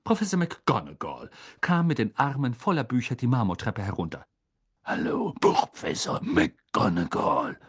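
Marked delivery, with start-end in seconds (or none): 4.8-7.6 slow, low raspy greeting; unhurried, gravelly calm; Moody, unperturbed. A narrator's reading. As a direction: greet calmly in a lazy growl. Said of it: "sagte Moody gelassen".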